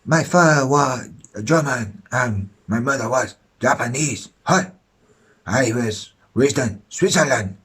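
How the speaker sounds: hint of fake Japanese accent; lightly put-on Japanese accent. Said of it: "he used a fake J-, Japanese accent".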